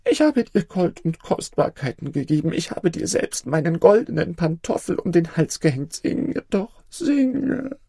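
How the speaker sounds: pleading, fading into a whispered singe doch; pleading emperor. Acted. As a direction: beg on until the voice fails. Dying emperor begs the bird.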